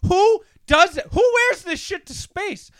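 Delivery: ranting at a cowboy hat in space; incredulous rant. Real, not acted. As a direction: rant in disbelief. Ranting about Bezos hat.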